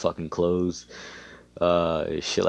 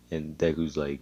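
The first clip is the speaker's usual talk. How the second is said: usual speaking voice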